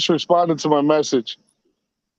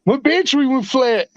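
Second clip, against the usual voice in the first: higher-pitched New Zealand imitation; New Zealand accent; 6 semitones higher than usual. Friend repeating himself to a puzzled listener, imitated New Zealand accent. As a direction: mimic a Kiwi friend, pitched up. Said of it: "New Zealand accent".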